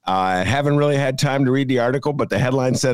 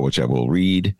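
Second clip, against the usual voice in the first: usual speaking voice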